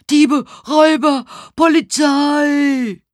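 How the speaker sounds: high, frantic shouting with the last word drawn out; loud calling out. A narrator's reading. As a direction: holler for the police. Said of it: "hörte ich jemanden laut rufen".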